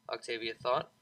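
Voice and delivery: ordinary delivery, nothing marked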